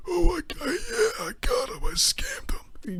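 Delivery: very high squeak sinking into a slow, whimpery whisper; mock old customer gloating over his scam; gloating old man. Old customer gloating after getting his refund, squeaky then slow and whispered. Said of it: "He goes".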